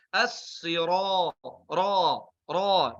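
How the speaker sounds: As-sira slow with a heavy rounded ra; heavy Arabic ra; thick ra. Shown as a demonstration. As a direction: stress the dark ra. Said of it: "bukan as-sira".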